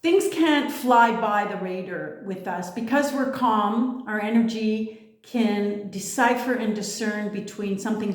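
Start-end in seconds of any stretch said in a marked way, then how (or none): none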